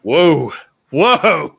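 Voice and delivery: surprised. Real, not acted. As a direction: caught off guard. Reacting to chat request.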